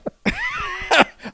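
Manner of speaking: high shrieking burst of laughter. Real, not acted. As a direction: let out a squealing laugh. Laughs after forgetting topic.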